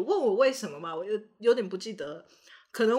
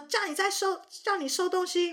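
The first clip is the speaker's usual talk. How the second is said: higher nagging mom; mock nagging mom; 8 semitones higher than usual. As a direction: nag the kids to tidy up. 妈妈命令收玩具. (高声唠叨的妈妈)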